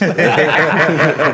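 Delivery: a burst of loud embarrassed laughter. Real, not acted. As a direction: laugh out loud at your own story. Laughing about mum seeing this.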